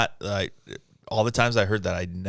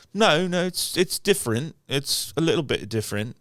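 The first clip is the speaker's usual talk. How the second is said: imitating an English friend, starting high; English accent; 6 semitones higher than usual. Host recalls Mike correcting him on accents, imitated English accent. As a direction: insist in a put-on English accent. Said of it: "Mike used to say".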